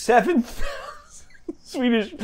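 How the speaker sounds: breaking into laughter on the order; giggling while repeating it; laughing through words. Real, not acted. Clerk laughing at the caller's huge order. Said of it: "are you laughing at me, sir?".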